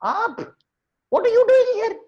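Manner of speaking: very high, loud squawked questions; startled shrieking monkey. Acted. Monkey demanding why the owl came, startled shrieking. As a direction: shriek it at an unwelcome visitor.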